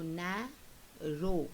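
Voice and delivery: "narrow" slowly, NA, pause, RROW; split into syllables. Shown as a demonstration. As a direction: say "narrow" one syllable at a time. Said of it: "say the word correctly".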